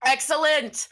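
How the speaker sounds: loud, high burst of triumph; delighted villain. Acted. Villain applauding Atticus for agreeing to help, delighted. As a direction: crow it like a showman.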